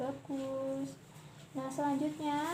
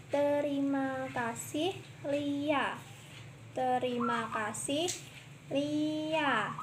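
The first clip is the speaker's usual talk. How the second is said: slow, careful model reading; over enunciating; much slower than usual. Shown as a demonstration. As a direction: spell it out slowly for the pupil. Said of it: "Perhatikan dan praktikkan kata-kata berikut ini".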